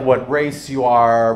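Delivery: ordinary delivery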